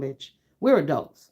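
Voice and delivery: usual speaking voice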